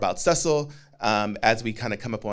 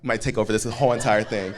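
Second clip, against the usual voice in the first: ordinary talk, nothing marked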